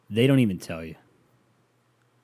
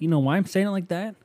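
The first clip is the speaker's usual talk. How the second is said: ordinary delivery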